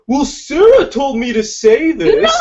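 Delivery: high, loud, slow comic outburst; mock yelling. S1 acts out Jack blaming Sarah to the room, mock yell. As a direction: yell it out slowly as a hammed-up accusation. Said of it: "Just start yelling".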